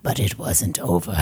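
low, hammed-up spooky whisper; a goofy Muppet menace. Host whispering a hammed-up spooky line, sounding like a Muppet. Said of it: "I sounded like a Muppet".

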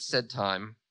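ordinary delivery

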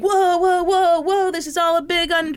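mock husband voice. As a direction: play the whiny excuse-making husband. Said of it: "he was like".

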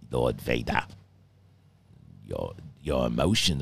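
low, soft, calm villain voice; quiet and deep, a restrained evil master; Emperor. Acted. Villain addressing Lord Vader about emotions, low calm menace. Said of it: "he's calm and he's not angry".

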